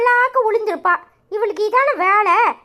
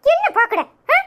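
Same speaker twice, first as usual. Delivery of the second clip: usual speaking voice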